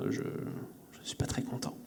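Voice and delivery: breathy whispered aside. Real, not acted. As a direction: whisper it under your breath. Whispered aside about hangover.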